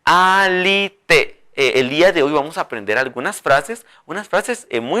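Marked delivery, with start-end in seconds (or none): 0.0-1.3 alite with a long final e; modeled word. Shown as a demonstration. Teacher repeats the word for learners. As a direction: let the last e of alite ring. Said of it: "Así".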